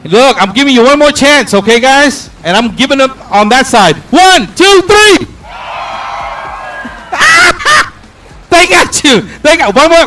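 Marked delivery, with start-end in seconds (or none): 4.1-5.3 shouted countdown. Real, not acted. Host giving the crowd one last loud countdown. 5.5-7.9 squealing laugh after the cheer; high laugh. Real, not acted. Host laughing high at the crowd's cheer.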